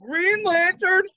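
drawn-out mocking. Mocking the movie.

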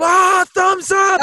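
high, loud, stretched-out holler; acted ecstatic scream; elated yell. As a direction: shout your approval across the way. Acted yell of approval to friend.

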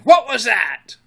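high, loud startled demand; startled cry. A narrator's reading. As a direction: cry out in alarm.

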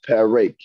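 PEREK said as peh-rake, long ay last; short eh first, then long ay in PEREK; wrong second vowel. Shown as a demonstration. Teacher showing students what it's not, PEREK ending in long ay. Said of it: "and it's not perek".

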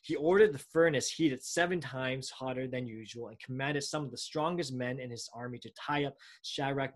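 ordinary delivery

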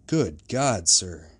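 an officer's startled outcry; exclaimed. A narrator's reading. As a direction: burst out with it in astonishment. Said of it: "exclaimed the officer".

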